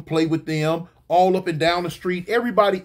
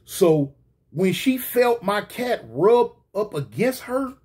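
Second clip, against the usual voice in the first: normal talking voice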